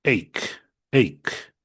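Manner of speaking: "ache" said slowly as "eik", teacher modelling; pronouncing ache. Shown as a demonstration. Teacher models "ache" for her online Spanish-speaking students, said as "eik". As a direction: spell out "ache" as "eik" for the class. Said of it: "¿Y cómo pronuncio?".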